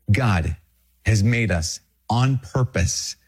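ordinary delivery

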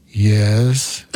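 low, drawn-out priest's "yes"; deep voice. Retold or imitated. Priest answering a child in the confessional, mock deep voice. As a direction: imitate a deep voice from behind the screen. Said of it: "I hear this deep voice go".

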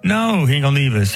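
normal talking voice

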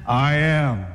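low, self-assured swagger; manly swagger. Acted. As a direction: drop low and own it. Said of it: "He had the man swagger too".

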